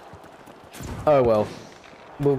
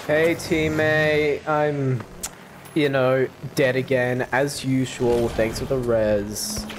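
ordinary delivery

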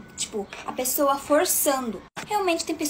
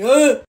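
deep mock shout; 2 semitones lower, 9 dB louder than usual. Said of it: "tem voz de homem".

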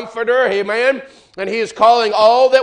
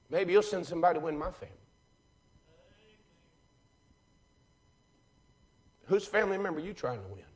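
hushed preaching; 12 dB quieter than usual. Acted. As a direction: drop to a near hush, as if confiding. Quiet intimate stretch of sermon.

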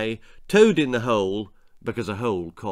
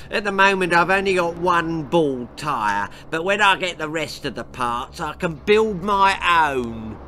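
smug dad; 4 semitones higher, 3 dB louder than usual. Acted. Pa explains.

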